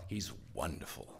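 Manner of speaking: low, very soft dry aside; dry mock praise. Sponsor telling others about ornery Earl, dry praise. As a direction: drop it to a deadpan undertone.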